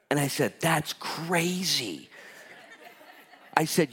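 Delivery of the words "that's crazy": very drawn-out mock amazement; slow, acted-out amazement; amazed reaction. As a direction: stretch each word out in wonder. Retells his amazed reaction to custodian.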